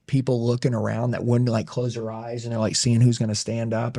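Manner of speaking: normal talking voice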